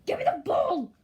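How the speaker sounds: loud, high, bossy yell for the ball; mock player shouting for the ball; demanding shout. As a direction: bark it like a greedy teammate.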